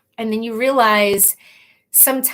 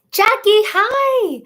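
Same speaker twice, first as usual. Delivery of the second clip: excited greeting; 10 semitones higher, 4 dB louder, much slower than usual. Real, not acted. Greeting a commenter by name.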